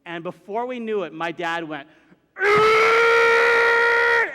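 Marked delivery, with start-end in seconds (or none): 2.4-4.3 loud, sustained revving noise; imitated engine roar. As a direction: roar like a van being gunned. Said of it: "just gunned right past the gates".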